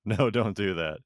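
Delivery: exasperated parent raising voice. Retold or imitated. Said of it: "they'll constantly be like".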